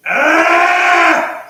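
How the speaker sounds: a burst of hungry frustration yelled out; high frustrated yell. Real, not acted. Starving streamer yelling out his hunger, loud high yelp.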